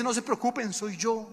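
high, light reassuring voice; reassuring Jesus. Retold or imitated. Jesus calms frightened disciples, gentle high reassurance.

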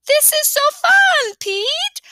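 put-on high and loud little boy delight; excited kid on a train; thrilled kid. A narrator's reading. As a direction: squeal it like a happy child. Fudge thrilled.

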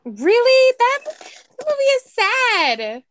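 can't believe that answer; surprised. Real, not acted. Cohost surprised at his favorite cartoon, high incredulous.

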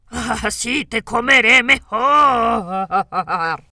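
lobo feroz, fuerte y muy lento; rugido de lobo. A narrator's reading. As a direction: ruge la amenaza como lobo. Lobo ataca. (lobo feroz rugiendo)

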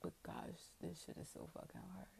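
whispered despair; exhausted whisper. Real, not acted. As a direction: let it fall out, spent. Drained admission, whispered.

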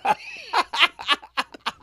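high, loud gleeful laugh; burst of laughter. Real, not acted. Telling a wild healing story, breaks into high laughter. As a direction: laugh out, overcome with joy.

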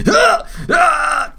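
high startled shrieks of shock; shocked scream. Retold or imitated. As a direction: yelp like a scientist seeing a Martian. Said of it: "and he's like".

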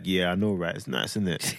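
low, self-satisfied schoolboy impression; cool boy reply. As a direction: play it cool after a compliment. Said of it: "He's gonna be like".

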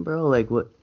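ordinary delivery

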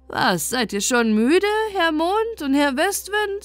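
angry, nasal snarl; angry nasal hiss. Acted. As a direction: snap angrily at moon and wind. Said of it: "eine zornige, näselnde Stimme ... die fauchte". (zornig, näselnd fauchend)

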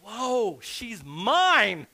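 stretched-out, swooping whoop of wonder; acted amazed delight. Preacher as Adam seeing Eve, amazed and thrilled. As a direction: play an awestruck man claiming his bride. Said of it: "In other words, he's going".

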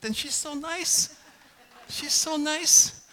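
high, slow gushing praise. Real, not acted. Pastor gushing about his loving wife, high and slow. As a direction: melt over how nice she is.

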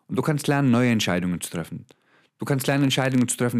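ordinary talk

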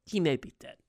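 normal talking voice

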